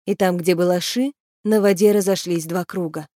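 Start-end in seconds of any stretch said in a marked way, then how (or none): none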